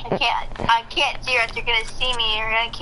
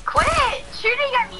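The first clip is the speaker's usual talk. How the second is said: high annoyed whine; 13 semitones higher than usual. Real, not acted. Annoyed at friend shooting.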